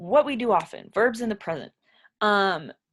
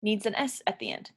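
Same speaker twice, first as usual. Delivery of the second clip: ordinary talk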